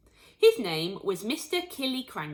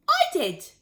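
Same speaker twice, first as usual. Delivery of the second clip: put-on higher, louder young boy; boy's voice; 10 semitones higher, 7 dB louder than usual. A narrator's reading. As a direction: say it like a proud little boy. George answers proudly.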